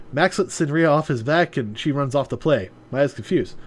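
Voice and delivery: plain reading voice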